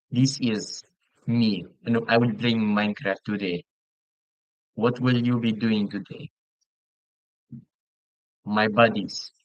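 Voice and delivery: demonstrated Russian-accented greeting; playful Russian accent on English; put-on Russian accent. Shown as a demonstration. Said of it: "I can also have a Russian accent".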